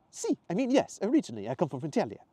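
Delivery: high, loud Italian voice; animated Italian accent. Acted. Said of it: "his faded Italian accent".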